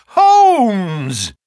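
loud, high cry, drawn out and falling; full-voiced cry of recognition. A narrator's reading. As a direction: shout the name long and high. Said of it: "I cried".

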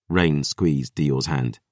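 ordinary delivery, nothing marked

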